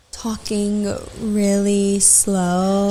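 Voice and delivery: dragged-out, slow imitation; talking really slow. Shown as a demonstration. Demonstrating how the strange guest talked, very slow. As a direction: stretch every word like the odd client. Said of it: "with this voice that I'm talking in".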